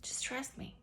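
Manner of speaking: soft unvoiced whisper; whispered reassurance. God reassuring her, whispered softly. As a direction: breathe it out gently, reassuring. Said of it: "He said".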